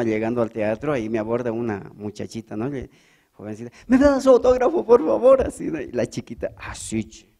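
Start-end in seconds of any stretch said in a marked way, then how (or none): 3.9-5.5 put-on squeaky young girl asking, higher; high girlish fan. Young fan begging the comedian for an autograph, high and girlish. 6.6-7.3 low breathy old-lady reply, unvoiced; a hint of an old voice. Young girl answering like an old woman, low and breathy.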